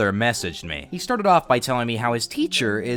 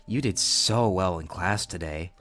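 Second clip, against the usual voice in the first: creepy teacher voice; 7 semitones lower than usual. Acted. Voicing teacher's creepy messages.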